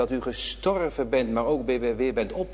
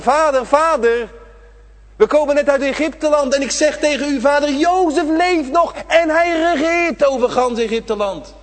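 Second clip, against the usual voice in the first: excited brothers; 14 semitones higher, 9 dB louder than usual. Acted. Brothers rushing to old Jacob with joyful news, loud and excited. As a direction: burst in with the big news.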